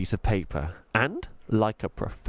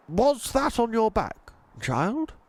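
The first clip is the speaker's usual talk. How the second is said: put-on gentle old tramp voice, a different timbre; old tramp Mister Stink; 12 semitones higher than usual. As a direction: speak like a kindly old vagrant.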